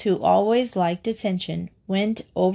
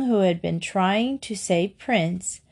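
ordinary delivery

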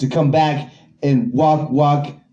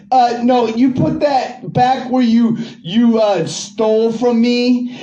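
raised, indignant pushback; angry retort; 6 semitones higher than usual. Real, not acted. Retort to bad guy accusing him.

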